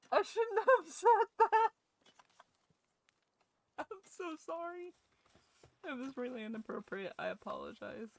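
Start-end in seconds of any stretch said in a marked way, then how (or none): 0.1-1.7 high, loud, slow mock gasp of shame; mock horror. Mock horror at own joke. 3.8-4.9 "I'm so sorry" said high and soft in a tiny mock-guilty voice; small mock apology.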